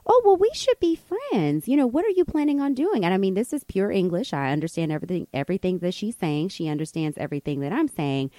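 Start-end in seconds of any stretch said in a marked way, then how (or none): 0.1-3.0 high, gushing mock friendliness; overfriendly stranger. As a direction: imitate someone eagerly making instant friends.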